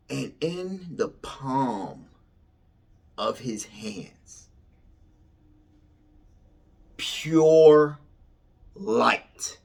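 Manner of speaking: very slow, drawn-out wonder; slow build to a swelling, awed finish; awestruck reveal. Real, not acted. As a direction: stretch each phrase and let it hang.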